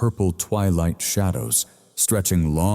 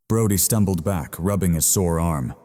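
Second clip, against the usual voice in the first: plain reading voice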